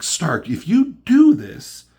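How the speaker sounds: loud, high, drawn-out retold threat; stern warning. T'Challa warns Stark not to do this, loud stern warning.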